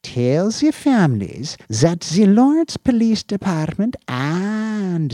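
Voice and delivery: high, loud sneering boss voice; perverse, mocking tone. Chief dictating condolences to his officer, perverse and mocking. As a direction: rattle off the sympathy like a joke. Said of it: "his tone becoming perverse".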